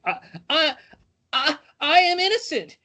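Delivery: high, halting plea of innocence; stammering protest. A narrator's reading. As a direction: stammer it out in alarm. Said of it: "you stammer".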